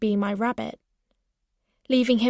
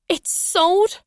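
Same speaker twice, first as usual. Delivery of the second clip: startled, higher-pitched question; shocked cry; 8 semitones higher, 4 dB louder than usual. A narrator's reading. Narrator hearing the house has sold, shocked cry. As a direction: cry out at unexpected news. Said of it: "I cried".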